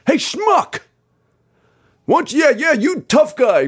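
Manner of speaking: high mock-tough heckler put-down. Mimicking the singer scolding a fan with a laser, high. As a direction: scold the laser guy like a rock star. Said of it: "he's like".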